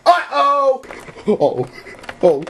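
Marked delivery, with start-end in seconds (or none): none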